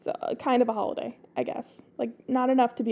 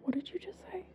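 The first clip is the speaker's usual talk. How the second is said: whispering; 6 semitones higher, 16 dB quieter, much faster than usual. Real, not acted. Startled by a weird word.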